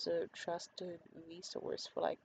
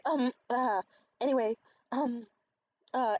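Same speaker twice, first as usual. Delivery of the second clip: raised, louder flustered stammer; imitating flustered man; 5 semitones higher, 7 dB louder than usual. Flustered date at the table after a phone call, imitated as flustered. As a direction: stumble over fillers, mimicking someone rattled. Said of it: "he starts just getting really flustered".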